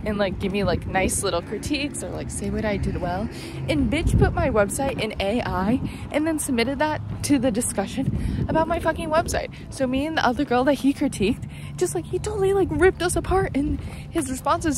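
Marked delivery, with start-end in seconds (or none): 3.7-14.8 higher-pitched indignant rant; worked-up anger. Real, not acted. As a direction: vent about it, pitch riding up. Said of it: "I'm just mad".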